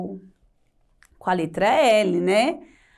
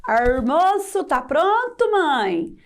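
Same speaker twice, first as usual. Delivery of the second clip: "Almoço" as "armoço", calling to mother; a light caipira country-boy voice; Chico Bento caipira; 5 semitones higher, slower than usual. Retold or imitated. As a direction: sotaque caipira leve. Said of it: "Se eu fosse o Chico Bento".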